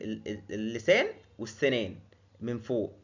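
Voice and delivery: ordinary talk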